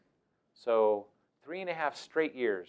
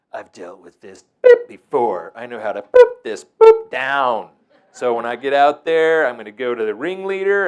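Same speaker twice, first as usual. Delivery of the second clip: cocky boast; 13 dB louder than usual. Retold or imitated. Confident director boasting.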